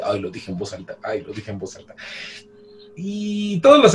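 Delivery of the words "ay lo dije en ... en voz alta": much softer, lower, a sheepish aside; embarrassed mutter to himself. Retold or imitated. Satoru regrets blurting a thought, sheepish soft mutter. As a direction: mutter it under your breath, caught out. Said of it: "Y hasta él mismo dice".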